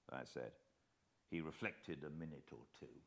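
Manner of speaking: ordinary delivery, nothing marked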